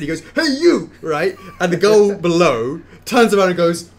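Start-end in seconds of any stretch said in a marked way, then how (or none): 0.4-0.9 put-on high-pitched, loud English accent; pitched-up English-accented shout. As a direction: hail someone loudly in a mock English accent. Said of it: "In this perfect English accent".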